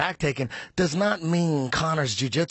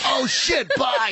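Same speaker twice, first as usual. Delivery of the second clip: flustered; 8 semitones higher, slower than usual. Retold or imitated. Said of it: "hung up and goes".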